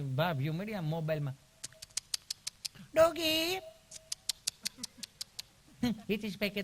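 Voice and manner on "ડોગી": high squeal of delight; calling to a dog. Retold or imitated. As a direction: coo high at the puppy.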